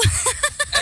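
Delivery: high, taunting laugh; mocking laugh. Real, not acted. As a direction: laugh high, not caring about the threat. Se moque de la rivale. (rire moqueur aigu)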